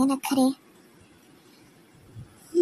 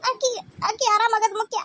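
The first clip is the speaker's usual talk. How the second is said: higher voice broken with crying; sobbing plea; 6 semitones higher than usual. Acted. Daughter-in-law hands over the baby, crying. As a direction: beg through tears that the child be well.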